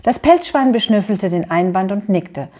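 ordinary delivery

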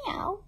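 high kitten meow; cat meow. Acted. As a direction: mew like a kitten. Imitating cat for children.

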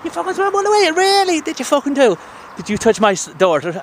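high-pitched mock Irish locals' voice; imitated Dubliners. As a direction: act out astonished locals in a squeaky pitch. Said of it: "And they were like".

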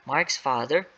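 ordinary delivery, nothing marked

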